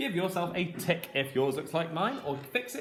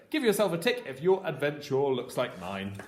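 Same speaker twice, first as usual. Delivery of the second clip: ordinary talk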